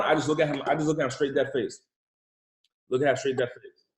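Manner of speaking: ordinary delivery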